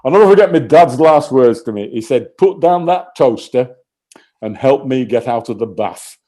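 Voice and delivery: loud, stoical northern English dad; northern English accent. Acted. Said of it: "I've gotta do this in a northern accent for the sort of stoical granite emotion behind it".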